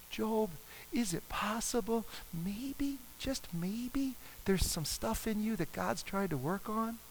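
soft, tentative and coaxing; gentle suggestion. Retold or imitated. Friends tentatively suggesting Job is at fault: soft, gentle coaxing. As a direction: ease the hard idea in gently. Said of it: "they didn't start off mean".

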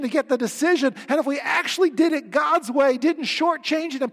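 loud, high-pitched sermon at full force; impassioned preaching. Acted. Preacher urging church to tell the whole gospel, impassioned.

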